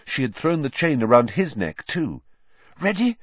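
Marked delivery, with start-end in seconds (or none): 2.8-3.2 breathless, high Hermione. A narrator's reading. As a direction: ask it out of breath. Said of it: "she said breathlessly".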